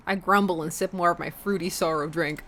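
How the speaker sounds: usual speaking voice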